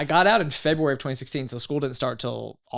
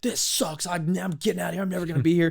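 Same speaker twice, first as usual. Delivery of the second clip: higher, acted exasperation; fed-up griping; 5 semitones higher than usual. Sailor after lunch wanting out, acted griping. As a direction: play the suddenly fed-up recruit. Acting out his fed-up flip-flop.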